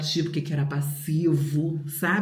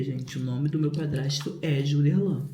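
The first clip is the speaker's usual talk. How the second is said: usual speaking voice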